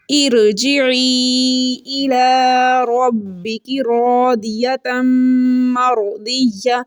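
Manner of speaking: slow, drawn-out tajweed chant; Quranic recitation. Shown as a demonstration. Teacher recites the verse for her students, slow chant. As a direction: recite the verse slowly, stretching each vowel. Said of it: "इसको आर्जी ज़ेर कहते हैं".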